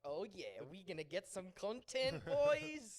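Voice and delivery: pre-ordering the deluxe edition, an overblown cheer; mock hype. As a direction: cheer like a pumped gamer on launch day. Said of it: "I was like".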